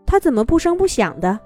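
ordinary delivery

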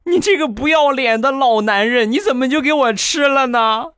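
put-on high, shrill child voice, furious; angry little nephew scolding his uncle. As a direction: rage like a kid robbed of his snacks. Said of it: "很生气的就说".

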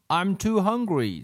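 lightly voiced, high-pitched gripe; complaining pupil. Terence joins the classroom complaints, high light whine.

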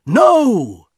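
loud, high 'no' from the husband; urgent cry. A narrator's reading. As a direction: cry out to stop her. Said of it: "he cried".

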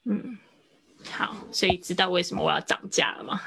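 usual speaking voice

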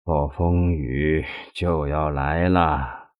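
very slow, low, soft foreboding; drawn-out, absent lament; dazed sigh. A narrator's reading. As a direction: sigh it out as if lost in thought. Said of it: "神情恍惚地叹道".

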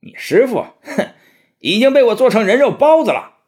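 hammed-up evil demon sneer; gloating demon. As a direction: taunt them with cruel glee. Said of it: "妖怪哈哈大笑道".

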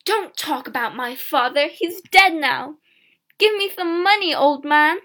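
loud, high angry outburst. A narrator's reading. Girl defending dead father to the peddler, angry. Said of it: "Alison was angry".

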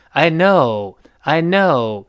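"know" stretched, then sliding down; drawn-out know. Shown as a demonstration. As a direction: apologetically lengthen "know" and let it fall. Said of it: "这个know稍微拖长". ("know"拖长后滑下来)

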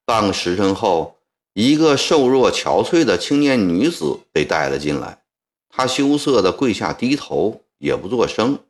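ordinary delivery, nothing marked

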